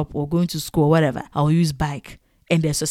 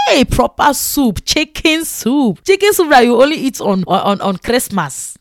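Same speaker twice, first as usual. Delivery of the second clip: excited; 5 semitones higher, 3 dB louder than usual. Retold or imitated. Excited about chicken soup.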